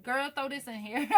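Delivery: bossy kitchen-table grandma; imitating grandmother. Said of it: "my grandmother just tells me, like".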